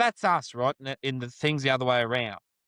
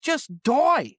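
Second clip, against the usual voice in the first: an exasperated outburst, higher and louder; frustrated rant; 11 semitones higher, 5 dB louder than usual. Real, not acted. Co-host venting over a wasted play, exasperated outburst. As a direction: snap it out in real despair. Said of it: "that little rant then".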